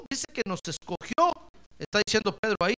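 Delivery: usual speaking voice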